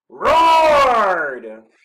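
huge, high shouted 'roared'; drawn-out roar. A narrator's reading. Snorklum scaring birds with one word, roaring. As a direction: let the word explode into a monster roar. Reader acts out the word roared.

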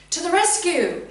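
heroic rallying cry. A narrator's reading. Boy rushing up the path to help, loud heroic call. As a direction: charge in to save her.

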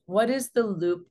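ordinary talk

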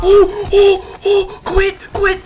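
high, loud gleeful hoots; excited whooping. Real, not acted. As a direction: whoop as rivals give up. Gloating as opponents quit.

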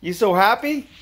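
put-on high, sing-song voice for a toddler; baby talk. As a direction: coo at the little one, high and bright. Dad coos at his son.

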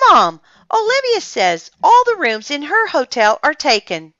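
high, loud tattling; complaining to Mom. A narrator's reading. Ian telling Mom on Olivia, loud complaint. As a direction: call out the grievance to a parent. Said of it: "complained Ian".

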